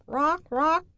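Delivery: high croaking raven call; raven caw. Acted. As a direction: squawk it like a big raven. Raven's loud call imitated.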